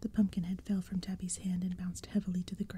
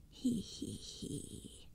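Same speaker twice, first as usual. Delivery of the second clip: evil dry cackle; 7 dB quieter, much slower than usual. Acted. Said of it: "The heads laughed together".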